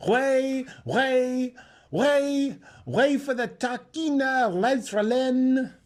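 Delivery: high, loud crier's call; ringing street crier's cry, the last word drawn out slow; crier shouting. A narrator's reading. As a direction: bellow it down the street to clear the way. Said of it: "was heard shouting out".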